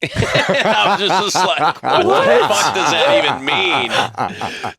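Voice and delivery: loud, high, slow words through laughter; laughing hard. Real, not acted. Roommate baffled by the father's message, helpless laughter. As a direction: crack up in disbelief as you talk. Cracking up retelling his baffled reaction.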